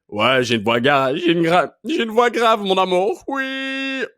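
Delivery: loud put-on voice climbing very high; special comic voice. Shown as a demonstration. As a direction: belt out a silly strained lover's boast, rising to a squeal. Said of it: "Ça, c'est mon talent spécial".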